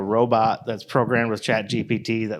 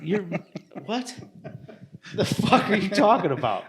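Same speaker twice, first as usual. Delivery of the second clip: laughing; 9 semitones higher than usual. Real, not acted. Incredulous reply to friend.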